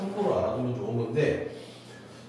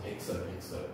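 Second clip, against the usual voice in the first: whispered repeat; 9 semitones lower, 11 dB quieter than usual. Real, not acted. Teacher repeats poem word softly.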